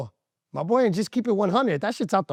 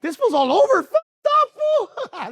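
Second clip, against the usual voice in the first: high, breaking into laughter; laughing; 4 dB louder, slower than usual. Real, not acted. Laughing at himself while telling the crowd he's all over the place. Laughing at his own rambling.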